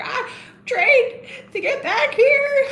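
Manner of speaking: high, drawn-out mock wail; imitating a wrestler crying out her promo; Charlotte Flair. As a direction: plead tearfully, hammed up. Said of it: "She's like".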